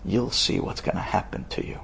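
hushed, threatening whisper; menacing whisper. Acted. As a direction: breathe the threat like a curse. Witch threatens the Israeli man.

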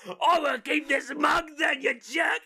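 put-on loud, high drunken yell; angry drunk shout. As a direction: hurl it at the bartender. Drunk throws mug at bartender.

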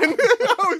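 ordinary talk

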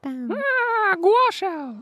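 silly high-pitched echo; high squeaky voice. Pretend. Squeaky echo of own pun.

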